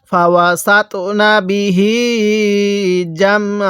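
measured chant with the last "hee" of বিহি held; slow Quran recitation. Shown as a demonstration. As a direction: recite slowly, stretching the end of বিহি. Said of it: "তাহলে পড়ুন".

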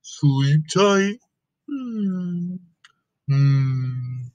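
sleepy elephant voice. Acted. Gerald low sleepy.

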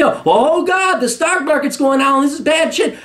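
high, shouting mock panic over the crash; mock panicked investor. Mocking investors who fear a crash, high panicked wail. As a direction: play a panicking investor wailing. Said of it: "You'd be".

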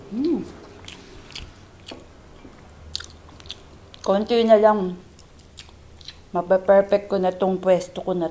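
chewing while talking; speaking around food; mouth full. Real, not acted. Seen 3: Food put in mouth at -1; jaw chewing while she talks.

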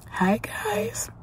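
real whisper from a lost voice; whispered greeting with no voice left; hoarse-voiced vlogger whispering hello to viewers. Real, not acted. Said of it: "Sorry about my voice".

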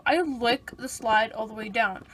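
ordinary delivery

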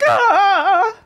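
high, loud mock anguished cry; loud comic cry of despair; hammed-up wail. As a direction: let out a theatrical wail.

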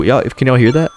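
usual speaking voice

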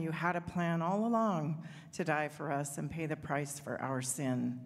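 ordinary talk, nothing marked

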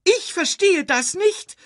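very high, indignant squeal; protesting. A narrator's reading. Timon answers Nala's reproach, protesting shrilly. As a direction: throw it back in shrieky protest. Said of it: "protestierte Timon".

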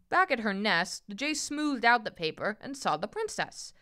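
ordinary delivery

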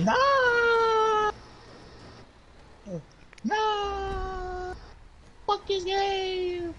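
high screaming rage; furious shout. Real, not acted. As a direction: scream it out in frustration. Raging at getting hit.

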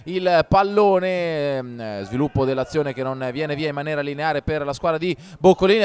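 normal talking voice

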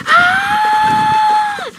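very high, loud scream; freaked-out scream. Real, not acted. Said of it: "I'm really freaking out".

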